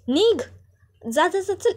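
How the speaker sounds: angry shooing. Acted. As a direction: drive her out, pitch way up. Aishwarya drives Janaki out.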